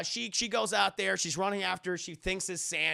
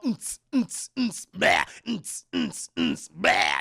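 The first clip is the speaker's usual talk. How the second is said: rhythmic grunts with loud bleats; imitated ghost noises; 5 dB louder, slower than usual. Narrator mimics dancing ghosts appearing, rhythmic imitated noises. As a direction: pulse like a beat, then blurt out loud. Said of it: "They appear and they're all".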